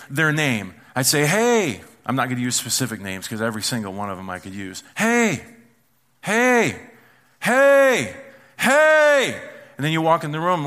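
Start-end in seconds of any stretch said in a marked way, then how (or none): 1.2-1.8 put-on higher, louder "hey" to a child; calling out. Parent calling to the kids two rooms away, calling out. 5.0-9.4 high, loud calls, building each time; escalating call. Acted. Parent calling unheeding kids two rooms away, escalating shouts.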